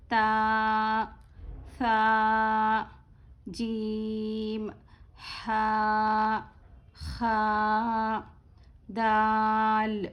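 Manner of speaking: each letter named slowly and evenly; slow letter recitation. Acted. Teacher reciting the Arabic letters slowly to her class. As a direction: recite the alphabet one letter at a time for pupils to repeat.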